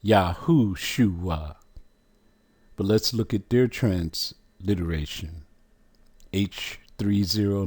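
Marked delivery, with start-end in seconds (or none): 0.0-1.6 Yahushua said slowly, syllable by syllable as ya-HU-shu-a; careful pronunciation. Shown as a demonstration. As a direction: spell out Yahushua slowly in sounds. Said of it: "It is pronounced".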